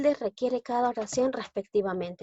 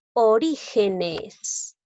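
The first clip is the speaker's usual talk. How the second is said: "Orígenes" drawn out slowly, RÍ stressed; very slow teaching "Orígenes", stressed second syllable; much slower than usual. Shown as a demonstration. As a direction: sound out "Orígenes" slowly, leaning on RÍ. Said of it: "fuerza de voz en la sílaba ri".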